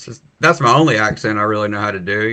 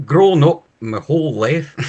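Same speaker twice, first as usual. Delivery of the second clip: broad Scottish vowels with a laugh; amused, thick Scottish accent; 3 semitones higher than usual. Shown as a demonstration. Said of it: "my grandmother is Scottish".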